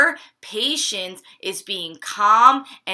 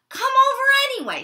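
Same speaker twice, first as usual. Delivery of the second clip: eager invite; 4 dB louder, much faster than usual. Acted. Molly calling her friends.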